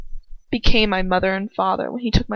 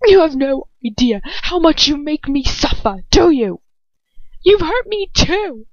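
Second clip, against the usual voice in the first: much higher, shaking accusation; shaking and spitting; 8 semitones higher, 4 dB louder than usual. A narrator's reading. Hermione accusing Lucius, voice shaking and spitting. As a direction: spit it through shaking anger. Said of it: "my voice shaking and spitting".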